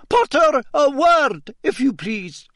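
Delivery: high, loud call across the room; calling over noise. Acted. Teacher calling Potter over the packing students, loud. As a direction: raise the voice over the bustle. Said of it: "called above the noise".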